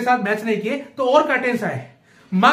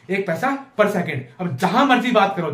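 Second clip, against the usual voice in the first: ordinary talk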